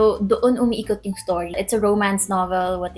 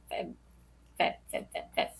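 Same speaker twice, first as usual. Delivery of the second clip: unvoiced whisper, trying the short form; whispered rehearsal; 10 dB quieter than usual. Real, not acted. Vlogger whispers Feb over and over, unvoiced. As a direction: breathe the word to yourself.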